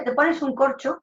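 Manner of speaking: usual speaking voice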